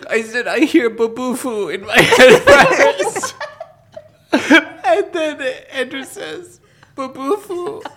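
high, drawn-out words cracking into laughter; squeaky, laugh-choked storytelling; real laughing fit. Real, not acted. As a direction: struggle to tell it slowly through giggles. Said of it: "I don't know why I'm laughing".

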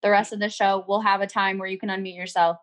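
usual speaking voice

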